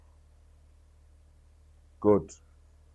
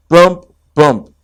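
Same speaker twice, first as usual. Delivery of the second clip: plain reading voice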